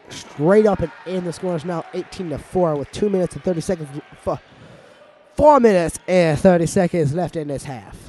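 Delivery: lively live commentary, building excitement; play-by-play. Acted. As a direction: call the game like a sports announcer. Play-by-play resumes.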